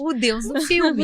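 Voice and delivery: amused emphatic echo; laughing repeat. Real, not acted. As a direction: repeat it while laughing along.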